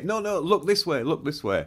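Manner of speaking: high insistent call to the pet, settling back; pleading with the dog. Retold or imitated. Owner begging the dog to look at the camera, high coaxing plea.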